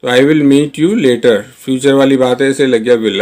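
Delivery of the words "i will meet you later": slow model sentence. Shown as a demonstration. Teacher modelling the English line for Hindi learners, very slow. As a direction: say each word slowly and clearly. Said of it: "teacher repeats model sentence".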